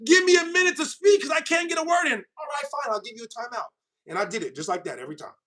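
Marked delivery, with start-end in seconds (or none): 0.1-2.1 high, pleading mock yell; mock timeout plea. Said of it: "be like". 2.1-3.7 granting timeout. Retold or imitated. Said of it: "that's what I told him".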